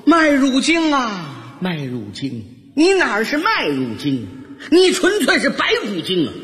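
drawn-out lament rising into an accusation; exasperated mock rant. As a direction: denounce a tin of malt drink, hammed up. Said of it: "我拖着这点麦乳精心里说呀". (夸张地埋怨、数落)

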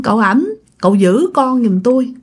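usual speaking voice

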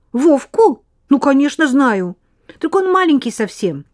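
put-on high, bright, rapid girl's chatter; chatty little girl. Lena answers eagerly.

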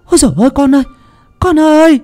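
high frantic calling out; mother searching the empty road, calling her son; calling loudly. Acted. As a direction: call out desperately into the dark. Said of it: "Mụ gọi lớn lắm".

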